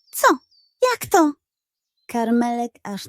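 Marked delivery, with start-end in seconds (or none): 0.1-1.3 shocked disbelief. Acted. Dog shocked by news.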